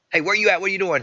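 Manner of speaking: high, loud imitation of a suspicious caller; demanding questioning. Boyfriend phones girlfriend to check on her, demanding.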